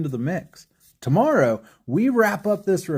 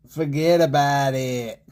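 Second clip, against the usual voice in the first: put-on terrible New York accent; 3 semitones lower than usual. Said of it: "That's such a terrible accent".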